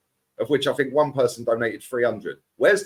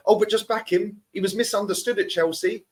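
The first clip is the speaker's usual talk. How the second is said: high-pitched parody of fans making excuses; mock pleading fan; 7 semitones higher than usual. Pundit imitating fans defending Kai Havertz, high mocking plea. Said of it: "And they sit there".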